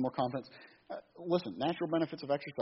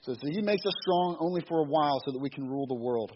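ordinary talk

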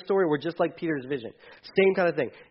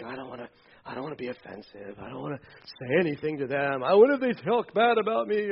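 hushed, nervous worrying thought; timid inner voice; 5 semitones lower, 5 dB quieter than usual. Retold or imitated. Believer's inner thought about evangelizing, voiced timid and hushed. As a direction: murmur it like a worried believer afraid to speak up.

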